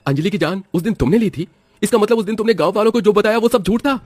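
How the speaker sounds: high-pitched, rising to loud disbelief; shocked accusation. Acted. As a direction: confront your wife in stunned alarm. Husband shocked at wife's crime.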